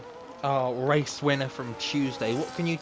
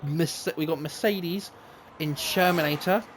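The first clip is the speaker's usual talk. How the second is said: live play-by-play; 5 semitones higher than usual. Acted. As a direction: race commentator calling laps. Live commentary of race laps.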